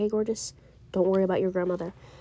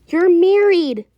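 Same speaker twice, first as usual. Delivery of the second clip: real raised voice, much higher and louder; emphatic insistence; 7 semitones higher, 10 dB louder, much slower than usual. Real, not acted. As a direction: drive the point home loudly, pitched up. Insisting to the worried partner.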